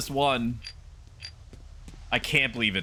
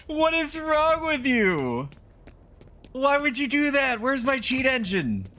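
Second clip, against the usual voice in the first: outraged shriek; 14 semitones higher than usual. Real, not acted. Streamer squealing at the game that reset him, higher. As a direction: squeal it in utter disbelief.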